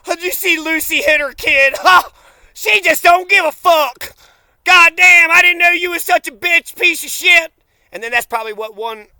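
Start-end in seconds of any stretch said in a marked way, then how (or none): 0.1-7.5 mocking relatives. Mocking the family's approval of Lucy.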